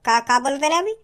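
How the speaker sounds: ordinary delivery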